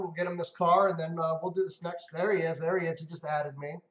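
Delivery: normal talking voice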